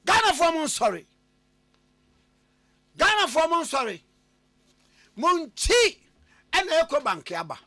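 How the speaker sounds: high, loud radio announcer call-out; ad read. Acted. Host hailing Ghanaians for Eco Bank, loud ad read. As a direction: call out to all Ghana with punchy pauses.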